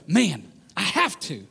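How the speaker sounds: slow, sincere, determined; heartfelt committed conviction; earnest resolve. Shown as a demonstration. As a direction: say it like a calling you embrace. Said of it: "your tone changes".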